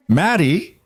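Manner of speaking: high, tight, scared call; fearful call. Acted. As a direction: call out for a lost daughter. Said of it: "his voice tight with fear".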